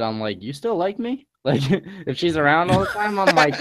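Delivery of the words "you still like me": retold disbelief, pitched up; puzzled mock wonder; dumbfounded. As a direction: ask it in mild, high-voiced amazement. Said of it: "I get more dumbfounded".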